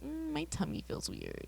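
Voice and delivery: small, high, soft queasy voice; queasy little voice. Retold or imitated. Voicing a viewer of gruesome scenes, small queasy voice. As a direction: say it slowly, like a child with a bellyache.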